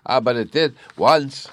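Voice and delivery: put-on high, loud triumphant child. A narrator's reading. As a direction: crow it in triumph. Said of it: "Bruno cried triumphantly".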